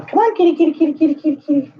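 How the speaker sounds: high, sing-song kitty call; calling the cat. Real, not acted. Owner coaxing her cat out of the closet, high sing-song. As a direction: coax him out with a high repeated call.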